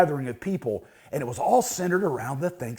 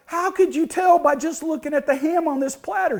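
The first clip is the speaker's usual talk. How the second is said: high-pitched, acted disbelief; incredulous question; 10 semitones higher than usual. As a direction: play the baffled husband, pitch way up. Husband challenges the great-grandma's verdict.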